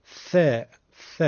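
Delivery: tongue tip against the teeth on each "ث". Shown as a demonstration. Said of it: "نوک زبون رو به دندوناشون میچسبونن".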